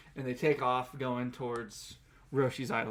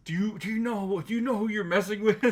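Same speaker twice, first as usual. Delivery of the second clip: mock indignation; 7 semitones higher, 3 dB louder than usual. Acting out affronted General Red.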